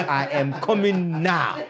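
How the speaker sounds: put-on playful Nigerian accent, swelling at the end. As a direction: accept the offer in broad Nigerian English. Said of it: "bring that Nigerian accent".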